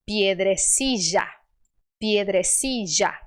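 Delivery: slow, low "piedrecilla", LL as in piedresizha; J sound for LL. Shown as a demonstration. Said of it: "é um J, que é o que eu uso".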